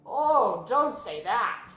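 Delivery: loud, slow pleading groan. A narrator's reading. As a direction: groan it out, begging her to stop. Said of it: "groaned Wilbur".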